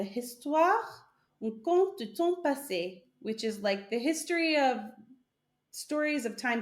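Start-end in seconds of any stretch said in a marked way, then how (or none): 0.0-3.0 French pronunciation. Shown as a demonstration. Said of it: "I'm hoping I'm saying that right".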